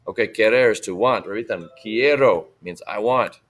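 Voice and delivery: usual speaking voice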